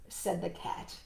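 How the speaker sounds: plain reading voice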